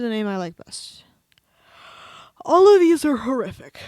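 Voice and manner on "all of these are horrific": loud outrage. Real, not acted. Outraged at the name options.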